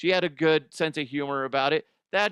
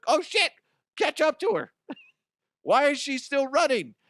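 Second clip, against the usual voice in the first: panicked firefighters; 13 semitones higher, 4 dB louder than usual. Pretend. Firefighters chasing rolling chair.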